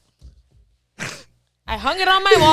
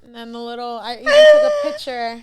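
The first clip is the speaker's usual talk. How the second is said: talking through helpless laughter; real laughing; 2 semitones lower, 4 dB louder than usual. Real, not acted. Confessing the sweater sale to friends, laughing. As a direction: barely get the words out for laughing. Cracking up while describing the sale.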